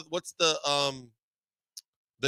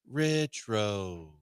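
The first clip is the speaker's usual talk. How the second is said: lower, quieter, pinky-up pronunciation; mock posh; 5 semitones lower, 5 dB quieter than usual. Teasing the town nickname with a mock posh air. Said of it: "it sounded like you had a pinky up while drinking".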